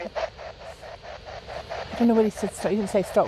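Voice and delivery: slow hushed whisper to a partner; slow whispered aside during a ghost hunt. Real, not acted. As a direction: whisper it slowly so the spirit won't hear. Aside during spirit session.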